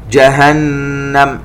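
جهنم slow, the doubled nun nasalised; ghunna on the nun. Shown as a demonstration. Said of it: "نغن النون". (غنة النون المشددة في جهنم)

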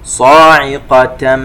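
slow, melodic tajweed chant; measured Quranic recitation. Shown as a demonstration. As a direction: recite slowly with held nasal sounds. Recites verse word.

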